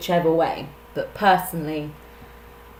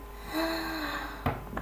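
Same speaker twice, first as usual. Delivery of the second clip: pained gasp; 12 semitones higher, 8 dB quieter than usual. Real, not acted. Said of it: "I just whacked my knee on my table".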